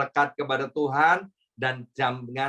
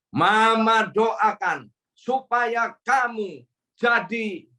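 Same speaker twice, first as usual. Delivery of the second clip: slow, higher solemn mother's pronouncement; slow, raised voice of a mother declaring her son's fate; mother pronouncing a curse; 7 semitones higher, 3 dB louder, slower than usual. Retold or imitated. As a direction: draw it out like a mother about to curse her son. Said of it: "Dan dia bilang gini".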